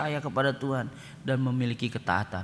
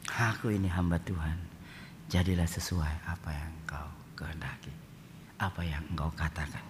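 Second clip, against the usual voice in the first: whispered, humble surrender to God; hushed prayer; 8 semitones lower, 7 dB quieter than usual. Acted. Preacher voicing Mary as a whispered prayer of surrender.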